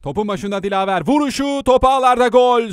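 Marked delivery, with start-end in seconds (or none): 1.1-2.7 goal call, much higher and louder; screaming football commentator. Real, not acted. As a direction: roar the goal to the crowd. Penalty goal scored, live call.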